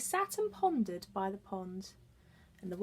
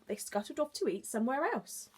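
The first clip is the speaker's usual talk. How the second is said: ordinary delivery, nothing marked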